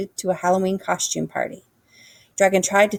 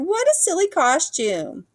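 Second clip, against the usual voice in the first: high, slow jeering sing-song; mocking animals; 11 semitones higher than usual. A narrator's reading. Animals teasing Dragon, high slow taunt. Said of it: "they cried".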